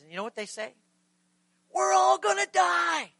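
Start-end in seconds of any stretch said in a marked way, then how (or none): 1.7-3.1 high, loud drawn-out yell; panicked shout. Acted. Disciples waking Jesus in the storm, loud panicked scream. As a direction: scream it out like someone about to drown. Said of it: "they said it really loud".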